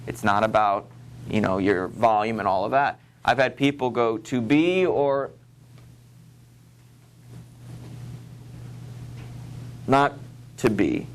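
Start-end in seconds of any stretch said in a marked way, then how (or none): 4.2-11.1 imitating a student struggling through memorized Shakespeare; halting recitation. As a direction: recite haltingly, groping for each next line. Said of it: "they take their time, and they get it".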